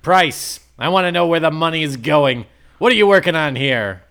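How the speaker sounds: loud, fast, pushy demand; angry demand. Acted. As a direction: demand answers, fast and hard. Norman demands answers from Price.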